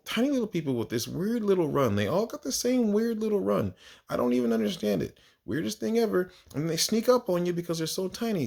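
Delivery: usual speaking voice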